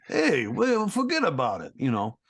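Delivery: put-on Brooklyn gangster accent, Tony Danza style; Capone Veggie. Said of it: "I basically voiced Tony Danza in One Piece".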